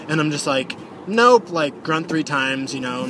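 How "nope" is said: loud, high sharp refusal; emphatic nope. Retold or imitated. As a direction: shut it down hard. Refusing to stay.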